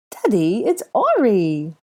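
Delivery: put-on high, loud, slow happy boy; beaming child. A narrator's reading. Boy beaming up at his father, high and happy. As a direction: tell him who you are, all smiles. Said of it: "beaming up at the blonde man".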